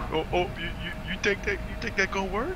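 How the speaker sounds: high-pitched, stammering put-on voice; imitated stammer, rising at the end; speech impediment. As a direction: act a man struggling to get words out. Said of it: "He got a speech impediment".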